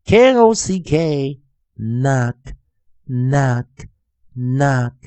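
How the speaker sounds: "knock" starting on N, ending in a crisp k; silent K. Shown as a demonstration. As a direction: model "knock" slowly, first K silent. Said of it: "我们再来一遍".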